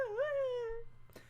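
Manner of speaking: very high squeaky mocking cry; mock kid squeal. Vlogger mocks Griffin's scream, very high squeaky voice. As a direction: parody a prepubescent boy's squeal. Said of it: "Griffin's kinda like".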